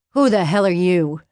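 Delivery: loud pained demand; groaning. A narrator's reading. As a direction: groan it out, annoyed and hurting. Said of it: "he groaned".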